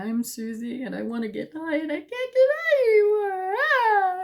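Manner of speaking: hammed-up sobbing introduction rising to a wail; mock crying. Introducing herself to treatment group, exaggerated wailing cry. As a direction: imitate your own crying, climbing to a high, loud wail. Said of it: "I was crying all the time".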